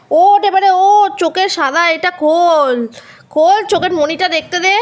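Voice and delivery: high, loud, slow urging to get up; loud call to wake up. Real, not acted. Owner calling the sleeping pet to open its eyes, loud urging.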